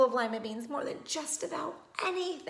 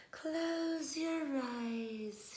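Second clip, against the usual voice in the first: very slow, soft, trance-like command; slow soothing therapist voice; hypnotic therapist; 3 semitones higher, 6 dB quieter, much slower than usual. Acted. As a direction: lull her under.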